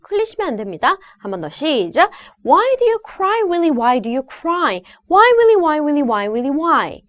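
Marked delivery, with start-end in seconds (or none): 2.4-4.8 sing-song chant, high opening WHY stepping down to a falling cry; rhythmic tongue twister. Shown as a demonstration. Coach leads students in tongue twister, lively rhythmic chant. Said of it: "이 리듬". 5.1-7.0 repeated "Why, Willy" stepping down from very high; rhythmic tongue twister. Shown as a demonstration. Coach demonstrates the feel for students, descending rhythmic chant. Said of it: "이 리듬".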